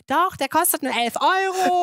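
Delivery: high, slow whiny protest; whiny kid voice. Retold or imitated. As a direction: whine it up high at mom. Retold argument with mother.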